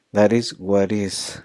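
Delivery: "That is what is" despacio con T suave; flap T. Shown as a demonstration. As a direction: liga las T como flap, lentamente. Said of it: "Okay, flap T".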